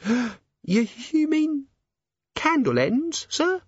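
put-on high, timid servant girl; nervous young maid. A narrator's reading. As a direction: stammer it out, frightened. Maid gasps realisation.

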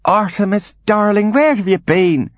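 very high theatrical mother voice; fluttery invalid mother; Angeline, doting mother. Acted. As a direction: greet a darling son in a high swoop.

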